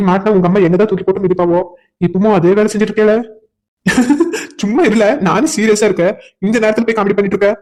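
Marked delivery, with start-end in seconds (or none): 3.8-4.6 short rising laugh; a quick giggle at the joke. Real, not acted. Friend jokes in serious moment.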